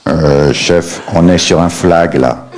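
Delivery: voix plus grave de flic; policier bourru. Retold or imitated. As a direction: joue le policier blasé. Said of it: "Le policier". (policier un peu grave et bourru)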